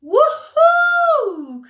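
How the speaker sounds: high, loud whoop of delight; joyful cry. A narrator's reading. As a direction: let out a soaring whoop. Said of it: "cried little Pip-Pip".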